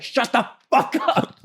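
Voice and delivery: high, exasperated mock yell; mock yell at the cat. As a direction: snap at the cat in a high, fed-up voice. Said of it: "yelling at her".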